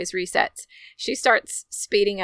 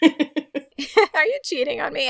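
higher and slow, laughing through it; laughing quote; 8 semitones higher, slower than usual. Retold or imitated. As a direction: savour the funny line, giggling. Repeats the funny line laughing.

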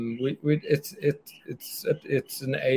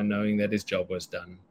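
usual speaking voice

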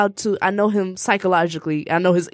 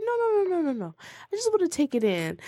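high, soft, mock-dainty string of refusals; prissy and proper; 7 semitones higher, 7 dB quieter than usual. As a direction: flutter up high like a very proper lady. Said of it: "talking really prissy and proper".

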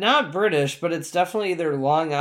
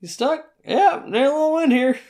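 mock character voice; 12 semitones higher than usual. Mocking the film character.

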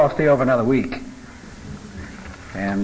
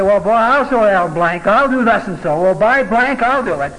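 higher and louder, a mock-furious vow; angry outburst; 7 semitones higher, 5 dB louder than usual. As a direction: play the hothead swearing he'll get even. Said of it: "We get angry".

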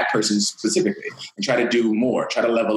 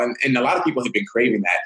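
ordinary talk

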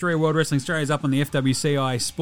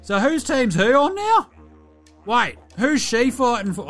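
confused viewer; 8 semitones higher, 4 dB louder, slower than usual. Retold or imitated. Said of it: "you're like".

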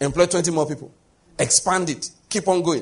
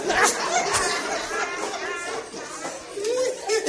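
high real laugh; 4 dB quieter than usual. Real, not acted. Preacher laughing at own joke.

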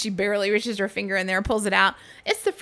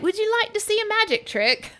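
imitating British guy; 13 semitones higher than usual. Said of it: "And he's like".

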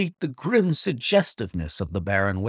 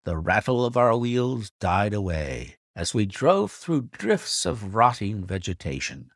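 plain reading voice

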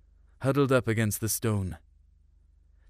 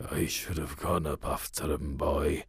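put-on low, softer voice of a dying old man; dying father's last words; 7 semitones lower, 5 dB quieter than usual. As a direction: breathe out the regret of a man on his deathbed. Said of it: "On his deathbed, do you know the last thing he said?".

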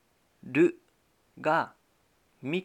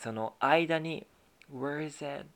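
ordinary talk, nothing marked

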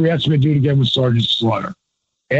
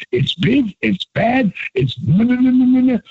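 hyped promo build; 10 semitones higher than usual. Retold or imitated. To the interviewer, an excited manager promo building up. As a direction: build the hype word by word. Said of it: "And then I went back to talking like myself".

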